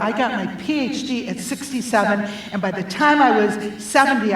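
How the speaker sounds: normal talking voice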